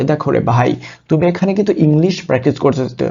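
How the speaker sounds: ordinary delivery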